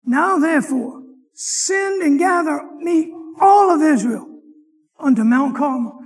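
loud, slow scripture reading at full force; preaching scripture. Acted. Preacher reading Kings to his class, booming=14. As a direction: proclaim it from the pulpit.

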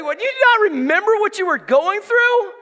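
loud, very high theatrical rebuke; hammed-up exasperated prophet shouting back; Moses. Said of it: "And Moses is like".

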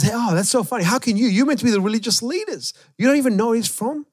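high, quick teasing voice; cheeky mockery. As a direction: poke fun at the religious leaders. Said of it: "in the most Aussie way ever, he starts making fun".

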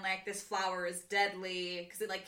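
ordinary delivery

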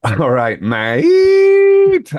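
"mate" shot up very high and loud; hammed-up Cockney "all right, mate"; mock London greeting. Host can't resist the London accent, very high and loud. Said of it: "I knew I would fail this test".

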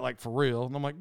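ordinary delivery

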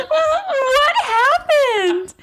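high, loud, stretched-out disbelief; hammed-up squeal of shock; shocked at herself. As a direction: draw out "what happened" in mock horror. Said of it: "I just went".

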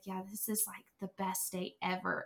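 lightly voiced quiet husband, understated; imitating reserved husband. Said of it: "her husband is very reserved, very introverted, very quiet".

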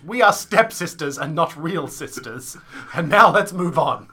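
slow, declaimed character line; mock stage dialogue. Host parodies a misheard line of musical dialogue, slow declaiming. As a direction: spell it out like clumsy exposition. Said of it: "dialogue that implicates them as".

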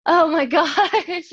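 laughing it out, drawn out slowly. Real, not acted. Guest laughing at the host's British joke, laughing. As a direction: caught off guard and laughing through it.

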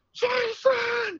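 long, high yell of a buddy's name; shouting a name. Retold or imitated. Lost hunter shouting for his buddy, a long high yell. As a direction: call out across the marsh. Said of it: "I just started shouting".

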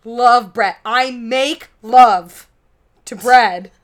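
loud mock-sincere confession; mock Oprah declaration. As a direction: declare your passion for bread. Oprah bread ad parody.

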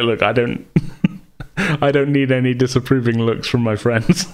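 giggly half-joking protest; laughing protest. Retold or imitated. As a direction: laugh it off while pleading with friends. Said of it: "I'm like".

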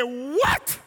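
loud what, soaring up in pitch; shocked disbelief. Retold or imitated. Wife hears husband is saved, astonished. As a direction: imitate a stunned wife's rising yelp. Wife drops broom at the news.

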